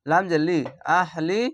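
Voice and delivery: normal talking voice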